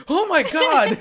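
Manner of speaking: high, loud panicked cry; mock terror. Inuyasha hides behind Miroku, mock-terrified cry. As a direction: yelp in exaggerated fright.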